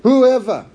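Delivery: WHOEVER thrown out high and loud; emphatic WHOEVER. Shown as a demonstration. Preacher quoting Jesus, emphasis on WHOEVER. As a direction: punch WHOEVER as a proclamation. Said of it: "notice that emphasis".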